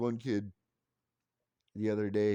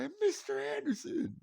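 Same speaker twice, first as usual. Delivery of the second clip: put-on kid calling out. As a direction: pipe up like an eager boy. Kids greeting.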